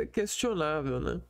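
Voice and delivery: ordinary talk, nothing marked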